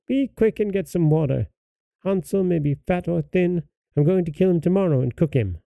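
put-on high-pitched old witch summons; witch calling. A narrator's reading. Witch calling the girl to work, high voice. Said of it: "she called to the girl".

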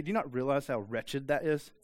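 ordinary delivery

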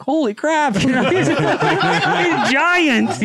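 high, laughing holy crap, stretched giant; amazed exclaim. Retold or imitated. Farmer marvels to the mother at her huge son, high and laughing.

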